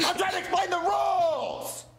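exasperated. Acted. Snapping back.

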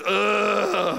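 long mock groan; groan of reluctance. Preacher voicing people who dislike responsibility, reluctant groan. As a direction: drag out a disgusted groan.

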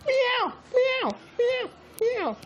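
very high squeaky meows; cat meowing. Pretend. Pretend kitten meowing during play, very high. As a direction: meow like a tiny kitten.

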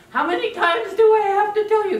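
high, loud exasperated parent; mock nagging parent. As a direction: play the fed-up parent.

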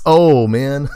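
ordinary talk, nothing marked

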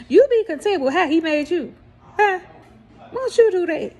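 normal talking voice

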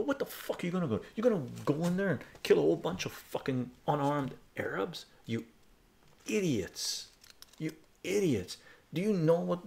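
high, heated reenacted tirade; going off on them. Telling off war-cheering pub yahoos, angry retold rant. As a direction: lay into them, drawing out each insult. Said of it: "I fucking went off on them".

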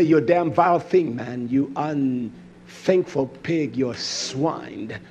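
low, slow contempt at oneself; self-berating. Retold or imitated. Preacher scolding himself before Yosef, low and scornful. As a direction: spit the insults at yourself. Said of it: "I'm talking to me this way".

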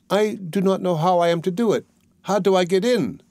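put-on high, innocent girl's voice. A narrator's reading. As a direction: play dumb as the girl.

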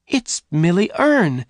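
high, slow exclamation; astonished recognition. A narrator's reading. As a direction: gasp out the name in a high voice. Jane recognises her lost friend.

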